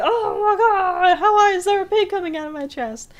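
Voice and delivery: high, loud mock scream of panic; mock screaming kids. Retelling kids screaming at a pig bursting out.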